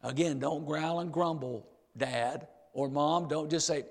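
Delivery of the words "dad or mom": ordinary talk, nothing marked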